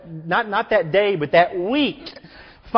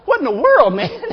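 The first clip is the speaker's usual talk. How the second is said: mock shocked; 6 semitones higher, 5 dB louder than usual. Townsfolk shocked by killer.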